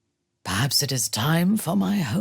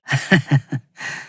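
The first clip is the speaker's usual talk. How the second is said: louder gleeful cackle; wicked laugh; 4 dB louder than usual. Acted. Delighting in battle, wicked laugh. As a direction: cackle at the flames.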